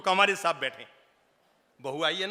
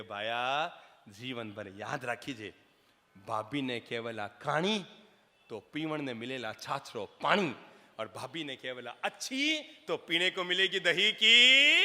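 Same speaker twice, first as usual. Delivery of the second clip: sing-song rhyme; 3 semitones lower than usual. Retold or imitated. Saint recites moral couplet.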